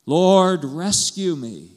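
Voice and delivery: big acted yell, drawn out at the end; desperate shout. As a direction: shout for help as if sinking. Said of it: "And he shouted".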